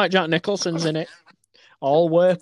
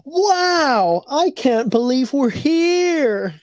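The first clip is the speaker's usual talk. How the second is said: put-on high, slow, awestruck kid voice; wide-eyed infomercial sidekick; 8 semitones higher, much slower than usual. Sidekick marvelling to the host in a mock infomercial, high and slow. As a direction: gush like a naive sidekick on an eighties tape. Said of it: "infomercial style".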